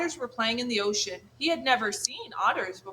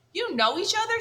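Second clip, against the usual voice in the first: surprised gosling; 11 semitones higher, 3 dB louder than usual. Acted.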